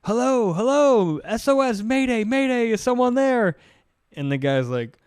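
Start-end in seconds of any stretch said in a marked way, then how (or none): 0.0-3.6 mock Mayday call. Sailor calling coast guard for help, mock Mayday plea. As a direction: call out urgently over a radio, acted. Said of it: "Mayday call".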